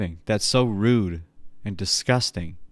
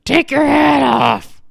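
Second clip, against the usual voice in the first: high, loud granny shout; angry granny yelling at her grandson; grandma shouting; 8 dB louder than usual. Retold or imitated.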